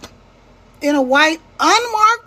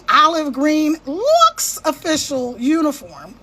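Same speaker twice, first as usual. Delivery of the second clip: usual speaking voice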